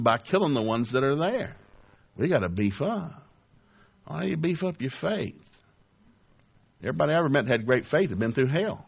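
ordinary talk, nothing marked

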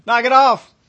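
a friend yelling to wake up the fooled guy; urgent warning. Acted. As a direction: shout the warning to snap him out of it. Friend shaking sense into him.

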